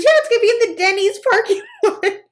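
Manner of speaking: high, quick, laughing; laughing saleswoman. Retold or imitated. Saleswoman inviting her to the Denny's lot, laughing. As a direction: gush it out through giggles. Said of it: "She goes".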